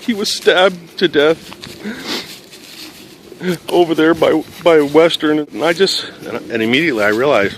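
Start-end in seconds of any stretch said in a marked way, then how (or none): 0.0-5.4 high, loud, halting grief; retold anguished outburst; imitated crying caller. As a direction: act out a distraught sister, drawn out. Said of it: "she's crying very hard".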